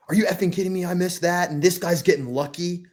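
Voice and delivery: angry golfer. Retold or imitated. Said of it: "guys that are like".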